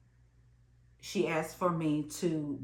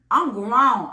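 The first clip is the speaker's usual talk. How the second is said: loud and higher, indignant; 4 semitones higher, 9 dB louder than usual. Real, not acted. Recalling being slung an instrument, a loud indignant protest. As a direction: insist on your dignity.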